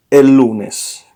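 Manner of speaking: «el» slightly stressed in «el lunes», said slowly. Shown as a demonstration. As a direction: lean on the article «el», then «lunes». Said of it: "To say on Monday, you say".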